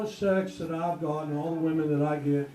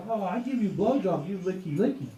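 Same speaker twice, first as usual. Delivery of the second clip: mock broken English; 3 semitones higher, 4 dB louder than usual. Comic imagining his girlfriend's reply, mock broken English. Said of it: "And she goes".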